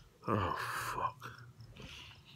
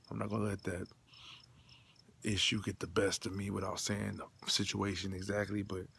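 ordinary talk

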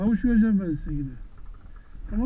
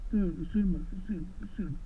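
softly discourage an animal from biting; hushed, unforced telling-off; gentle scolding; 8 dB quieter, much slower than usual. Real, not acted. Gently telling animal not to bite.

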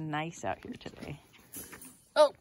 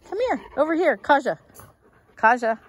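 high, loud sing-song call; calling the dog; 10 semitones higher, 12 dB louder than usual. Real, not acted. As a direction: call her over up high and bright. Calling the dog Kaja over.

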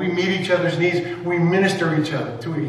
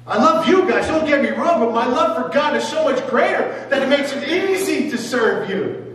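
Jesus to his friends; 8 semitones higher than usual. Acted. Jesus telling his friends, bright and laughing. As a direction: let the love spill over, laughing.

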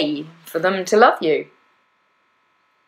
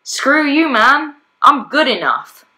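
higher, defiant retort, slowing at the end; defiant comeback; 5 semitones higher, 4 dB louder, slower than usual. Retold or imitated. Talking back to her inner critic, defiant. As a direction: tell the voice off, chin up. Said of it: "disciplined enough to say".

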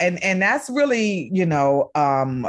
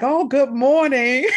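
higher, bright bus-driver hello; high, sing-song mock greeting; cheerful greeting; 8 semitones higher, 4 dB louder than usual. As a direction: greet riders with sunny cheer.

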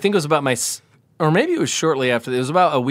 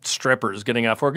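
usual speaking voice

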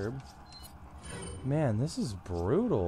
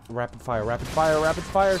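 raised, loud urgent repeats; frantic calling out; 7 semitones higher, 9 dB louder than usual. Real, not acted. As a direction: call it out higher and louder in panic. Frantic shooting under attack.

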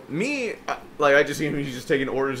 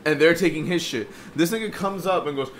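ordinary delivery, nothing marked

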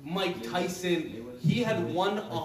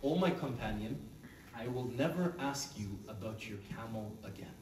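mock whisper; 4 semitones lower, 6 dB quieter than usual. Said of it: "he whispers into his ear".

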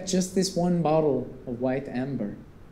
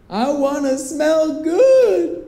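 eager blurt; 12 semitones higher, 8 dB louder, much slower than usual. Retold or imitated.

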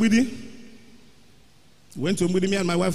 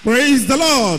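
preacher's call; 6 semitones higher, 5 dB louder, slower than usual. Real, not acted. Pastor calls out to congregation.

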